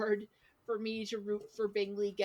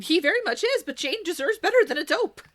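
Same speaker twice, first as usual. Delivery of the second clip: louder and higher, insisting; emphatic louder insistence; 8 semitones higher, 10 dB louder than usual. Real, not acted. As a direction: hammer the point home. Emphatic defense of Jane.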